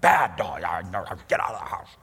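hammed-up owner scolding pet; mock scolding dog. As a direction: bark it at the dog in jest. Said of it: "you're like".

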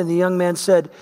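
ordinary delivery

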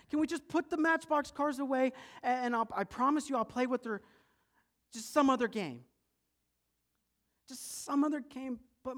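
put-on high, loud child's whine; desperate pleading. Boy begging mom to put cars away, desperate pleading. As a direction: beg and complain at full volume.

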